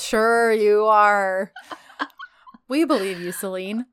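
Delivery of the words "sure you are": slow, stretched mock agreement; drawn-out sarcasm. Real, not acted. Host doubts she is offended, slowly sarcastic. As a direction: stretch "Sure you are" right out, disbelieving.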